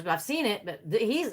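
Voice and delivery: ordinary delivery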